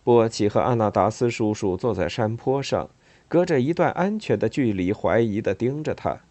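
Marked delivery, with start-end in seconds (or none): none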